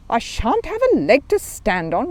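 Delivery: slow, worried repeat; a mild note of alarm. Acted. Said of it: "repeated Paddington in alarm".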